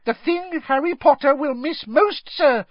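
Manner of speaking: very high, urgent elf squeak; high-pitched earnest elf; Dobby. A narrator's reading. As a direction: squeak it gravely insistent. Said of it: "squeaked Dobby".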